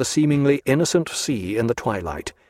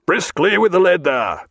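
higher, louder brisk command; cried out; 6 semitones higher, 3 dB louder than usual. A narrator's reading. Captain urging the leadsman, cried out. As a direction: call it sharply to the leadsman. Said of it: "cried Jack".

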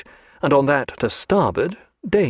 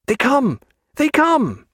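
high, rising cry of delight; thrilled high-pitched exclamation; excited sighting; 14 semitones higher, 3 dB louder, slower than usual. A narrator's reading. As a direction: call out the sighting, higher the second time. Character spots the awaited ducks.

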